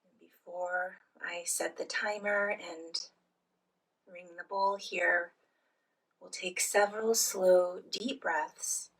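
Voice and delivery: soft, unhurried phrases with long pauses; guided meditation. Real, not acted. Leads guided breathing meditation.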